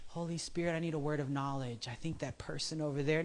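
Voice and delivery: soft, unsure murmured prayer; mock hesitant prayer. Mocking an overcautious prayer, soft and unsure. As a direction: ask quietly, overthinking it. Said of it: "you see somebody".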